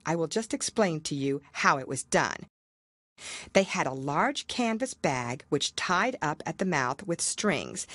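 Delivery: plain reading voice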